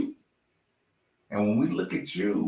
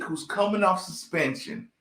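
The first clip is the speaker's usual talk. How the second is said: ordinary delivery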